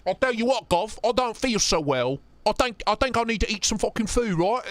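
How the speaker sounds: lightly put-on cockney accent, playful; mock British accent. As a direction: een plagerig Brits accent, licht aangezet. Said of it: "I'm allowed to do that. I have British friends".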